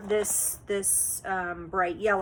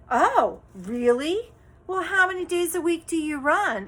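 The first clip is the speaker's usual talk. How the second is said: higher mock-surprised clerk voice; patronizing; 7 semitones higher, 3 dB louder than usual. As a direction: imitate the clerk's fake interest, talking down. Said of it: "it was just so patronizing".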